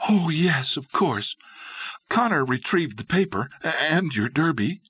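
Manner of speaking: light, eager woman's voice; lightly voiced Dolly. A narrator's reading.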